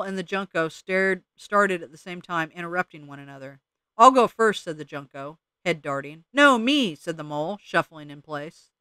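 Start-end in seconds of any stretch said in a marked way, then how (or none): 4.0-4.6 eager blurt. Acted. Junco butts in eagerly. 6.3-6.9 insistent interruption. Acted. Mole interrupts, arguing turn.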